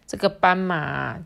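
ordinary delivery, nothing marked

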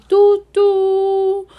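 high, loud whistle call; steam whistle toot. Acted. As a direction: toot like a train. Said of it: "用汽笛欢迎".

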